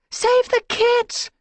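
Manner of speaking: high, loud, slow yowl. A narrator's reading. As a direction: yowl across the clearing. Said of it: "Squirrelpaw yowled".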